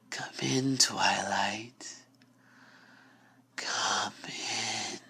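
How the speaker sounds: slow, soft luring whisper; coaxing whisper. Acted. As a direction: lure her in gently.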